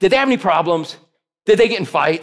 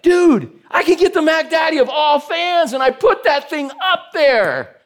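excited; 6 semitones higher than usual. Real, not acted. Hyping the fancy shower fan.

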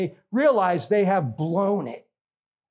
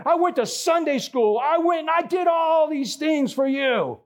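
angry protest; 10 semitones higher than usual. Retold or imitated. Churchgoer shouting at God over being sent to hell, furious and high. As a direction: rail at God in outrage, pitch way up. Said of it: "They are so angry at God".

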